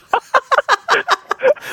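high rising cackle; a high, squeaky rising laugh; distinctive high laugh. Real, not acted. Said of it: "웃음이 약간 재결인데".

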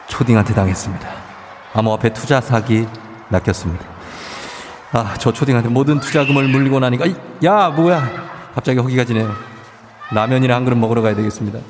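low, hushed ASMR narration. Acted. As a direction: speak low and close like ASMR. Low ASMR travel segment.

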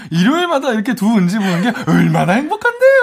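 high, laughing, over-sweet protest; exaggerated high voice. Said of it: "꼭 거짓말하면 한쪽 눈만".

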